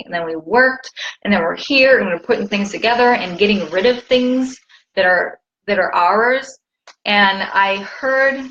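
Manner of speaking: ordinary talk, nothing marked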